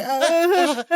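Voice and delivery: imitated high, uneasy laugh; put-on nervous "uh" and giggle. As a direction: mimic someone laughing nervously to stall. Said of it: "he's nervous laughing".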